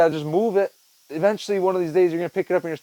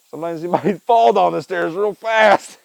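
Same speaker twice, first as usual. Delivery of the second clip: laughing through the words, slowed; chuckling as he tells it; giggling at his own fall; 4 semitones higher, 4 dB louder, slower than usual. Real, not acted. Laughing while telling of falling.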